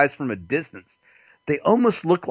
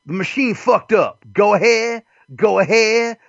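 higher, pushy brush-off, repeated; hammed-up impatient drive-thru worker; mock rude cashier; 7 semitones higher than usual. Cashier telling the customer to move on, higher and pushy.

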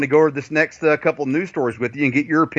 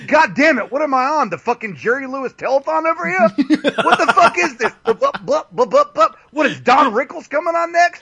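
high-pitched comic protest, incredulous; mock exasperation; 10 semitones higher than usual. Guest retells his reaction to the host, mock exasperation. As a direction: rant in a much higher voice, hammed-up.

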